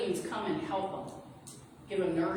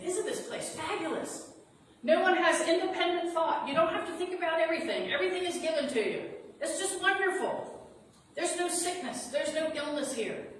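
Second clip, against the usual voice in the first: higher, louder, sugary sales pitch; seductive evil being; 8 semitones higher, 4 dB louder than usual. Acted. Evil being speaking through Charles Wallace, high and syrupy. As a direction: sell the place sweetly, like a false host. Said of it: "Speaking through him and saying".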